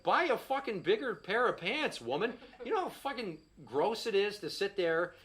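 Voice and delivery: usual speaking voice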